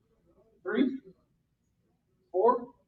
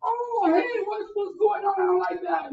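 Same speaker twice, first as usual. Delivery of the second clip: mock upset whine, much higher and louder; whiny fighter; 10 semitones higher, 4 dB louder than usual. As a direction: play the brawler who just got hit. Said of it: "Until he hits me back".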